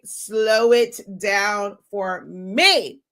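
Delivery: very slow, each word drawn out long. Shown as a demonstration. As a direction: crawl through it, word by word.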